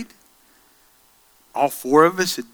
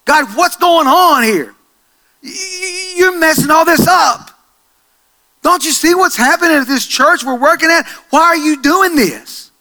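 loud, higher, frustrated outcry to God; angry prayer; 10 semitones higher, 5 dB louder than usual. Retold or imitated. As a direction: shout it at God, fed up and hurt. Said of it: "I'm mad".

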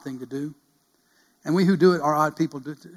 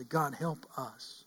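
quiet plea; 10 dB quieter, much slower than usual. Retold or imitated. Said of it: "kneel at the altar and say".

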